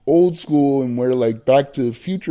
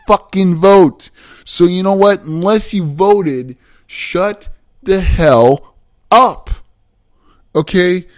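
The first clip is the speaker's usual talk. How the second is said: much louder, slow, hammering each word; angry shout; 3 semitones higher, 9 dB louder, slower than usual. Real, not acted. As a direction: yell it at the people who didn't vote. Rant peaks at non-voters.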